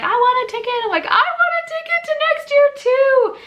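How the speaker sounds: very high, loud giddy voice; two winners squealing with joy together; excited squeal. Retold or imitated. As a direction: squeal it like a thrilled kid. Friend and she both squeal.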